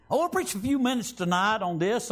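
ordinary talk

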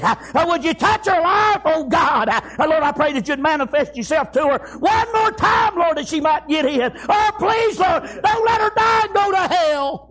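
pleading prayer building to a high shout; crying out in prayer. Acted. Daddy praying for his daughter's soul, crying out. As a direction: start earnest, build to an anguished high plea. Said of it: "hear him out there crying out".

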